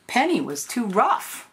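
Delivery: plain reading voice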